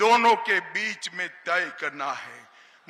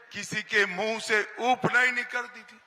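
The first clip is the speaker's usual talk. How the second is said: ordinary talk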